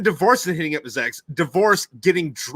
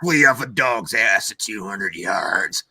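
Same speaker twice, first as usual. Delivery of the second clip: gruff movie character; 6 semitones lower than usual. Retold or imitated.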